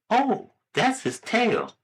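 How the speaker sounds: high, drawn-out mom voice; slow, high motherly answer. Acted. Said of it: "the little boy asks his mother".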